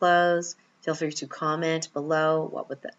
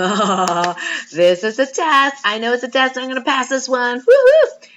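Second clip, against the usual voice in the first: loud, thrilled cheer building to a whoop; excited; 8 semitones higher, 12 dB louder than usual. Retold or imitated. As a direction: cheer like you just aced the test. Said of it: "You get excited about it".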